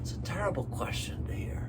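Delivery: fast hushed whisper; teacher whispering a sad aside. Real, not acted. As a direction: drop to a quick whisper.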